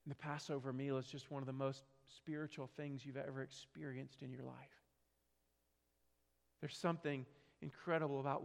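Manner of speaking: quiet reflective sermon narration; hushed preaching. Acted. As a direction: draw them in with a hushed solemn voice. Quiet solemn sermon storytelling.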